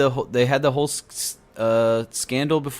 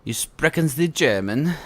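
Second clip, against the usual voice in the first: higher-pitched fake German accent; comic pidgin German accent; mock German accent; 6 semitones higher than usual. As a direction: ham up a bad German accent. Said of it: "You think my German accent is that bad?".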